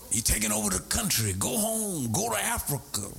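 retelling a bitter stranger's insult; angry old man. Retold or imitated. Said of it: "he was using profanity at me".

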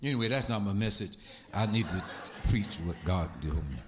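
low, steady sermon voice; calm preaching. Acted. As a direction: settle back into teaching, low and calm. Calm low teaching after the rant.

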